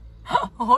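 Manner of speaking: sudden high, loud gasp of fake shock; mock gasp. Reading a cheeky comment, she answers with a mock gasp. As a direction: gasp as if scandalised.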